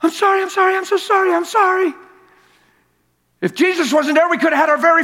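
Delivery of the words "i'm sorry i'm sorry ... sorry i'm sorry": high, pleading, sobbing imitation of his wife; imitating crying wife. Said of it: "all she can say is".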